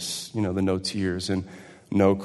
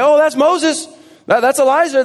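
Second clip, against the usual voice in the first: high, loud, delighted exclaim; excited recognition; 14 dB louder than usual. Acted. Disciples spot Moses and Elijah, thrilled and loud. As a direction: burst out like spotting old friends.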